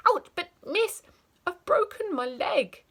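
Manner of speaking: put-on injured child complaining to teacher; hurt child.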